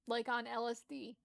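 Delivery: ordinary talk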